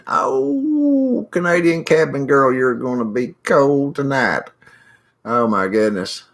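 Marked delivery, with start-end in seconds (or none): 0.1-4.5 high, slow sing-song sympathy; mock pity. Real, not acted. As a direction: coo it like you feel her chill. Pitying a viewer in frigid cold.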